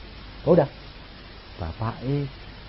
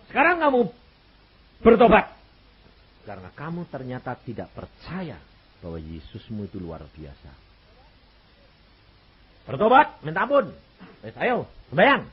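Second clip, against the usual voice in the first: preacher's command; 4 dB louder than usual. Retold or imitated. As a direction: order her to repent with force. Commanding the mother to repent.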